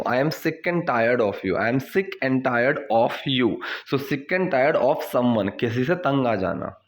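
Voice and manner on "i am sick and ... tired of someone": very slow, careful teacher reading; slow model sentence. Shown as a demonstration. Teacher to students, slow clear model sentence. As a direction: lay out each word for learners. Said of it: "teacher models phrase".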